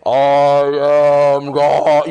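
very slow, loud, booming low voice; hammed-up almighty God voice. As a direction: intone it hugely, stretching every word. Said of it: "what the voice of God sounds like".

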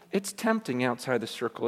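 ordinary talk, nothing marked

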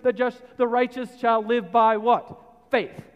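loud, high preaching to the congregation; full-force preaching. Acted. Preacher pressing the point on faith, full force. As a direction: drive it home from the pulpit.

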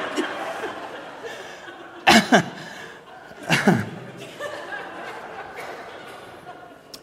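long trailing breathy laugh; real laughter that winds down. Real, not acted. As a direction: keep laughing after the joke. Continued laughter.

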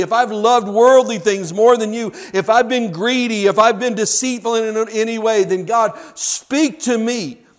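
impassioned cry to God; fervent preached prayer. Acted. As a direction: pray it out with building fervor.

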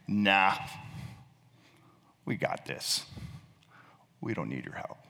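dismissive, half-whispered brush-off; defiant sneer. Retold or imitated. Humanity to God, defiant sneer. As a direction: wave off the help with quiet scorn. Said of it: "with kind of a defiant sneer".

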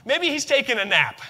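high, loud taunting jeer; mocking Elijah. Elijah jeering at the prophets of Baal, mocking. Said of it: "he starts mocking them".

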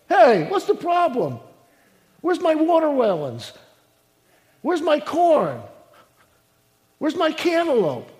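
loud, high, hammed-up complaint; mock indignant demand. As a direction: call out in pretend outrage at the garden. Acting out demanding answers from garden.

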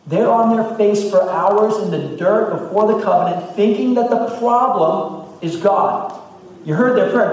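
high, loud preaching build; fiery preaching. Acted. Pastor retelling the elders' despair to his church, fired up. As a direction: preach it with rising fire.

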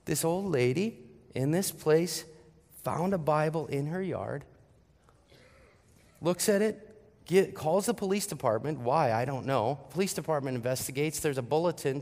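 ordinary talk

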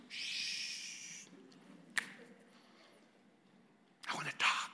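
hushed unvoiced shush; whisper. Wife shushing her husband at the silent retreat, whispered. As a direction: shush him in a breathy whisper. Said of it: "she went like this".

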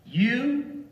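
high, emphatic "you"; stress on YOU. Shown as a demonstration. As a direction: lift "you" sharply after a pause. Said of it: "means that it's you".

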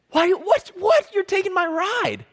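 very high, loud squeal of disbelief; hammed-up high-pitched outrage; incredulous protest. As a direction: protest like your car is being taken. Voicing owner whose ride is taken.

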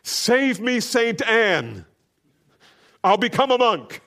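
high, loud terrified cry for help; panicked shout of a man in a thunderstorm; Luther's cry. Retold or imitated. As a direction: cry out to the saint as lightning strikes. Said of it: "and he cries out".

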